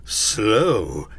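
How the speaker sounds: loud, echoing repeat of the word; affronted ghost. A narrator's reading. Ghost repeating Scrooge's word, affronted. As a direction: throw it back as a spectre.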